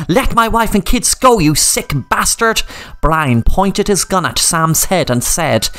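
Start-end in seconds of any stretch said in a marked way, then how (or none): 0.1-2.6 shout. Acted. Sam to captor about his family, mild shout. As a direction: yell it at the villain. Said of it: "Sam shouted".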